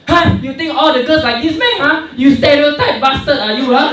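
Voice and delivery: high, loud indignant yelling; angry girl. Retold or imitated. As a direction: play an outraged girl telling him off. Girl angrily confronting the speaker.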